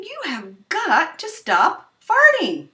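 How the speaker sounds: put-on high child scolding her dog gently; high kid's plea; high little girl voice. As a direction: plead with the dog like a young girl.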